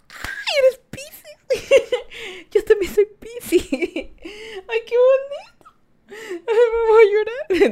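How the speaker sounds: very high, loud, slow hammed-up sob-squeal; hammed-up weepy squeal; mock teary squeal. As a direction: play it like a fake tearful fan. Mock emotional over shared sign.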